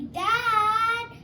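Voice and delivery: high, loud call to dad. Acted. Kids calling out to their dad.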